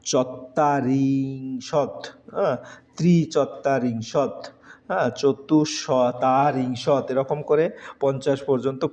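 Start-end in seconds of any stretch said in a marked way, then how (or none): 0.0-7.1 চত্বারিংশত said very slowly; teacher spells out চত্বারিংশত; slow numeral demo. Shown as a demonstration. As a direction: stretch চত্বারিংশত syllable by syllable. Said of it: "বিয়াল্লিশকে বলে".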